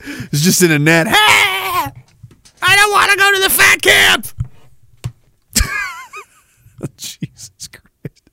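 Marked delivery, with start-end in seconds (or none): 1.1-4.3 high, slow, sobbing shout; mock crying wail. Captured camper wailing as they haul him out, high slow cry. 5.5-8.2 laughing. Real, not acted.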